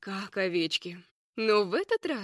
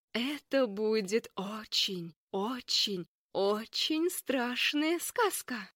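slow ominous drawl; slower than usual. Acted. Leya drawing out scary promise.

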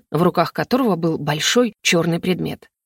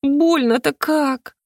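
slow, high pained moan; pained groan; 7 semitones higher, much slower than usual. Acted. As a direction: groan it through the pain. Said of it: "тихо застонала".

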